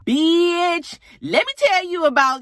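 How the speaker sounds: high, loud, excited gossip voice; gossipy friend on the phone. Retold or imitated. Friend calling Sierra with gossip, high excited voice. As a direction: dish the news like a messy girlfriend.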